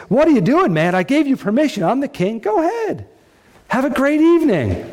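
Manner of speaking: high, incredulous king pushing Uriah; high mock king, incredulous; exasperated king. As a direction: needle him to go home. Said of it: "He says to Uriah, in essence".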